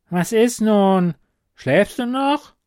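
high, loud, drawn-out prodding; impatient kobold. Acted. Kobold presses for the next wish. (ungeduldiger, hoher Kobold)

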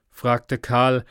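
ordinary delivery

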